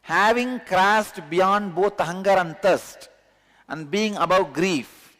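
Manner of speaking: solemn recitation. Acted. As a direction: proclaim the verse solemnly. Reads translation with force.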